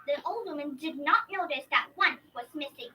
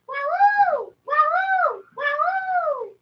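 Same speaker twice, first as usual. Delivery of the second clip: much higher, louder howling wah-woo; wolf howl; 13 semitones higher, 13 dB louder, much slower than usual. Acted. Wolf calling the sheep with a loud high howl. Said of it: "I would call them like this".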